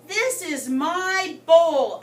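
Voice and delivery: slow, loud, drawn-out yell; screamed. Acted. Goldfish loses it at his tankmates, slow loud yell. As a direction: belt it at the whole crowded bowl. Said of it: "I screamed".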